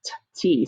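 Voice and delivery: "T, T" as crisp consonant pops, no vowel; letter sound. Shown as a demonstration. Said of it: "That's the first sound we heard".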